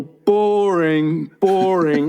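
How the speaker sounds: mock-bored, stretching out the word; bored rejection. Older woman turning down the young man's offer, drawn-out boredom. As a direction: imitate a woman unimpressed, drawing it out.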